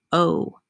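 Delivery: "oh" as a drawn-out long O; long O sound. Shown as a demonstration. Teacher introducing the week's long O sound, "oh" sounded alone. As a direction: say "oh" like the letter's name. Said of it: "and long O sound".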